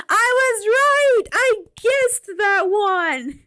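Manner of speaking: triumphant high cheer, slowed; gleeful shout of victory; excited. Real, not acted. Excited at guessing right.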